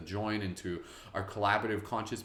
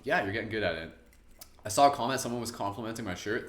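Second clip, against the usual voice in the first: normal talking voice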